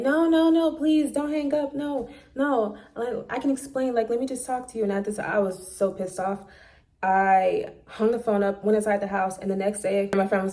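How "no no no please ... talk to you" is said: high, pleading mock voice; imitating his begging. Mimicking him on the phone, begging her not to hang up, high and pleading. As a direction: whine like a man begging not to be hung up on. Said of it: "Here he is begging".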